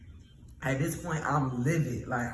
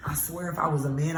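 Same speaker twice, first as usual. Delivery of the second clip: ordinary talk, nothing marked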